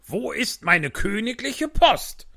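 high, slow, nagging whine; whiny king. Acted. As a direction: whine like a spoiled child-king. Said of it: "quengelte der König".

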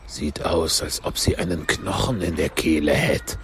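low, gruff-mannered creature aside; Gryphon voice. A narrator's reading. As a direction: speak deep for the Gryphon. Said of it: "sagte der Greif". (tiefe Greifenstimme)

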